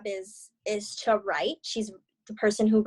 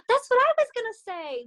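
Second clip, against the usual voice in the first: sharp gasp, then much higher delighted words; excited gasp; 10 semitones higher, 3 dB louder, faster than usual. Real, not acted. Excited agreement with colleague.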